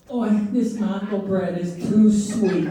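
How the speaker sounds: fussy complaining mother-in-law. Retold or imitated. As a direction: whine like a picky mother-in-law. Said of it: "My mother-in-law loves a fine wine. Like".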